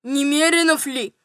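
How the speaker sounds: tearful voice. A narrator's reading. General asking steward at night, tearful. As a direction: plead as if near tears. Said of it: "спросил он плачущим голосом".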